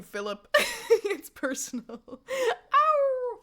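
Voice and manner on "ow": high playful howl at the moon; wolf howl. Pretend. Streamer howls for the full moon, playful wolf howl. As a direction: throw back your head and howl, laughing. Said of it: "For the full moon".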